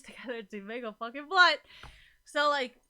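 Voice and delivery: loud, laughing through the words; half-shouted, giggling rant; laughing loudly. Real, not acted. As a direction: crack up while pushing the point. Laughs at own blunt rant.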